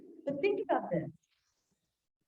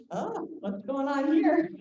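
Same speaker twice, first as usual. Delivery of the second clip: slow, raised-pitch mock inquiry; lightly voiced son; 6 semitones higher, slower than usual. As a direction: lightly act a dad walking in. Son walks up on the scene.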